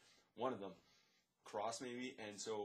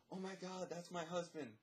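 high-pitched imitation of an alarmed woman; shocked wife; 7 semitones higher than usual. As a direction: voice the wife's shock in a raised pitch. Voices the shocked wife in story.

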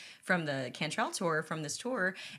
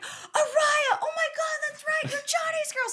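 very high, loud thrilled outburst; excited realization; 7 dB louder, slower than usual. Retold or imitated. Rock star realizing she's Johnny's girl, very high and loud. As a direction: blurt it out as it all clicks. Jerry realizing who she is.

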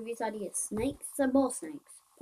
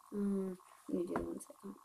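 normal talking voice

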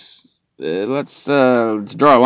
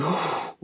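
soft, high gasp of delight; excited gasp; 10 semitones higher, 9 dB quieter than usual. Retold or imitated. Girlfriend lights up at his answer, excited gasp. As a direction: gasp like she just lit up. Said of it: "then she lit up".

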